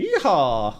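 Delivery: Yeehaw in a mock Western drawl; cowboy voice. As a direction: whoop it like a cowboy. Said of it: "I just like speaking like a cowboy".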